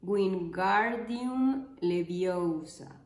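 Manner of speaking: "Wingardium Leviosa" very slow, GAR and O drawn out; stretched spell syllables. Shown as a demonstration. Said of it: "Hermione le corrige un hechizo".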